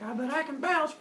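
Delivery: put-on dumb kid. Teacher voicing a cliff-jumping kid to the class. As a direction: lightly voice a reckless kid boasting.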